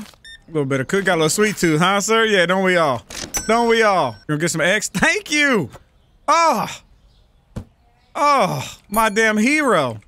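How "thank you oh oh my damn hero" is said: thrilled thanks. Real, not acted. Thrilled at customer buying eggs.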